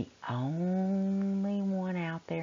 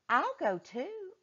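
girl voiced; 13 semitones higher, 4 dB quieter than usual. A narrator's reading. Marley voiced in story.

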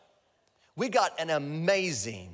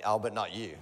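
the devil's lie; 8 semitones lower, 3 dB quieter than usual. Retold or imitated. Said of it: "And he's gonna say".

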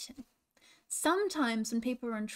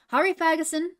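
higher, louder urging; urgent command; 5 semitones higher, 8 dB louder than usual. A narrator's reading. Angelica Roo hurries Ferguson to the branch, urgent command.

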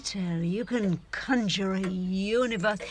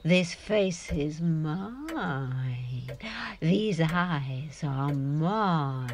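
slow possessive; 2 semitones lower, much slower than usual. Acted.

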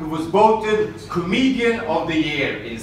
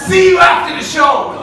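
loud, high threatening yell; shouted heckle; 11 semitones higher, 13 dB louder than usual. Retold or imitated. Heckler shouts a parting threat at the comedian. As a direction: yell it at the comic on stage. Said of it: "he shouted".

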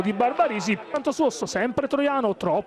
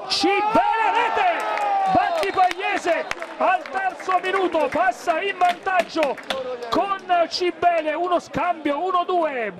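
goal scream; 6 semitones higher than usual. Real, not acted. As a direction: erupt as the home team scores. Home side scores in live commentary.

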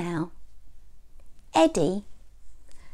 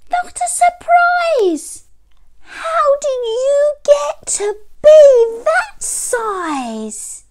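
very high, loud, drawn-out astonishment; amazed little boy; 7 dB louder, slower than usual. A narrator's reading. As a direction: marvel loudly in a high child voice. Story boy amazed at giant teddy.

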